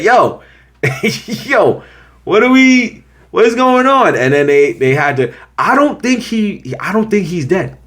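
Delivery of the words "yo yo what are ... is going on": excited disbelief. Retold or imitated. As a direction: squeal with disbelief. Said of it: "'Cause I say".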